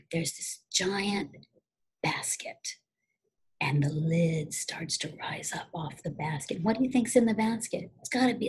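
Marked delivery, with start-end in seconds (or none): 0.1-6.6 slow, hushed whisper building suspense; creeping storyteller's whisper. Acted. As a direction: whisper it slowly like a scary story. Spooky suspense in the dark building.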